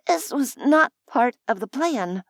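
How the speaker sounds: ordinary delivery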